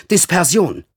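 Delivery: curt call. Acted. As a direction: call the word out sharply. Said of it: "rief sie knapp".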